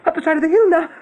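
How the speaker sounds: high, pleading panic; frightened rabbit pleading with his brother Hazel; desperate terror. Acted. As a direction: beg him to see the horror. Fiver's vision reaches its peak.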